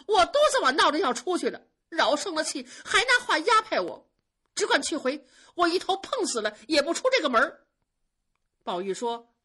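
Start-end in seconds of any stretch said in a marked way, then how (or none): 0.1-7.7 高く大きく速く、泣きながら食ってかかる; high, loud and fast, a crying outburst of protest; tearful protest. Acted. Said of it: "晴雯哭着说".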